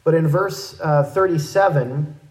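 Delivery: ordinary talk, nothing marked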